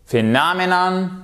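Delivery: "Phenomenon" high, as "fe-NAH-me-nan"; stress on NOM. Shown as a demonstration. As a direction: lean on the second syllable of "Phenomenon". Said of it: "regardez où se trouve votre accent".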